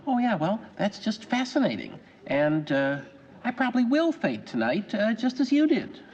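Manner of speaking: high, arch mock-polite retort; passive-aggressive politeness, pitched well up; indirect annoyance. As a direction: feign interest while needling them back.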